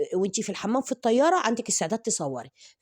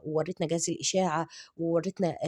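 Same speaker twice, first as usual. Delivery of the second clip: ordinary talk, nothing marked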